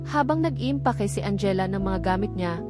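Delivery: ordinary delivery, nothing marked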